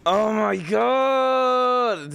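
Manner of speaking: long, loud, high-pitched exclamation; drawn-out outcry. Real, not acted. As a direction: stretch it out in loud disbelief. Reacting to the clip's letdown.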